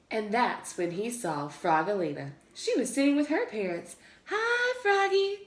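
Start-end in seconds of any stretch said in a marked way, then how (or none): 4.3-5.4 high, slow sweet hello; girl's call. A narrator's reading. Frogalina greeting Froggy across the restaurant. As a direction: call across the room, friendly. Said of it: "called Frogalina".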